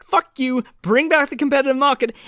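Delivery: mock protest shout. Protester outside Best Buy store, mock shouting. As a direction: call it out like a mock protest chant. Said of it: "and I shout".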